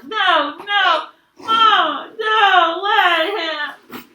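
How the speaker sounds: high, loud, drawn-out protest; panicked pleading. Acted. Stuck boy begs Mama, panicked. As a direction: cry out to stop it.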